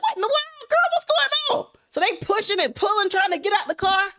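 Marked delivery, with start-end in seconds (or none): 0.0-1.7 high, startled squeal of disbelief; shocked disbelief. Retold or imitated. Two friends locked in the new car, high shocked squeal. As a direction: voice jumps way up in shock.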